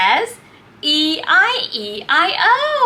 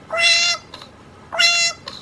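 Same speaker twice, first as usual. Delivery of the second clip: high cartoon duck quack; 10 semitones higher than usual.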